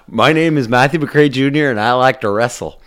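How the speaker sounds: a hint of the kid reciting his name; imitating a kid's intro. Host echoing a kid from the clip, light kid voice. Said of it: "the kid that said".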